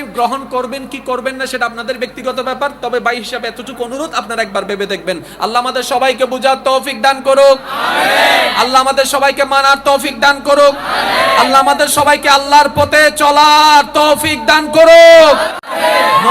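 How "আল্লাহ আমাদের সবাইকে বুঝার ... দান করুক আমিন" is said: loud, rising supplication building to a shout; shouted prayer. Acted. Preacher leading a closing prayer, building to a loud shout. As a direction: lift the prayer up with the crowd.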